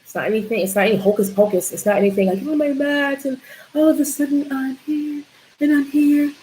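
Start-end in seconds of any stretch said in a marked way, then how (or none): 2.5-6.3 higher, airy mock-wonder voice; poking fun at instant magic, voice raised; mock dreamy. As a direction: play the naive believer, higher pitched. Mocking a magical mat belief.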